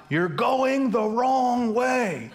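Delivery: high, slow stretched-out yell; retold shout. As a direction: call it long across the lanes. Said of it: "and they shout".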